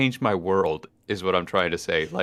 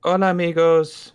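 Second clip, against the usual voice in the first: cheery Spanish greeting; 8 semitones higher, 6 dB louder than usual. Real, not acted. Greets audience in Spanish, singsong.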